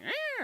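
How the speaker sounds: high, sharp mock cat "Eh"; imitating a cat lashing out; cat hiss. Host imitating a cat turning on him with a high "Eh". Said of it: "all of a sudden, like".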